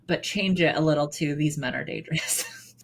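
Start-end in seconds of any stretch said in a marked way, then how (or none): 1.3-2.7 muttered. Retold or imitated. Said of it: "I would like to revamp that".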